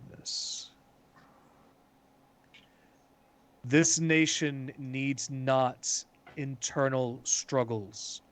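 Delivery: slow, deliberate pronouncement with heavy pauses; stern official addressing assembly. Acted. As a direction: address the nation, word by weighty word. Game master plays an official's speech.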